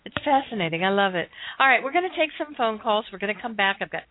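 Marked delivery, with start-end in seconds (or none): none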